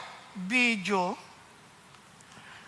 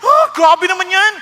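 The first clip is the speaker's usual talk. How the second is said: 1 mock outrage; 6 semitones higher, 7 dB louder than usual. Quoting angry hearers.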